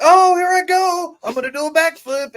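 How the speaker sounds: high, hammed-up hype voice; squeaky cocky show-off; mock Zach. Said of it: "Zach was like".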